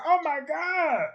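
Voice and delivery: hammed-up anguish; dismayed wail. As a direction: cry out in mock despair. Dismay at imagined breakup.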